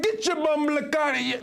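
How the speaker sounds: slow, high mock Jamaican patois; Jamaican accent. Mocking how Jamaicans would answer him, slow high Jamaican accent. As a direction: drag it out like an outraged Jamaican local. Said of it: "They'd be like".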